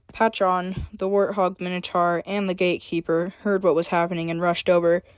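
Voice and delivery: plain reading voice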